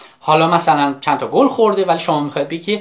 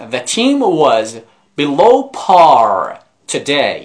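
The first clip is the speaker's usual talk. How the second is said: slow model reading; 4 dB louder, much slower than usual. Shown as a demonstration.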